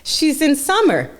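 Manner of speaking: put-on high-pitched pitch to a small child; imitated excited coaxing. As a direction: act out selling a kids' show to a toddler. Coaxing child to watch a show.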